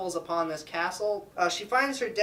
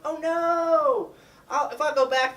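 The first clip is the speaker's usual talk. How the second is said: high, loud, hammed-up dismay; mock alarm; 11 semitones higher, 5 dB louder, slower than usual. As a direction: cry out like a distressed heroine. Belle sees father in trouble.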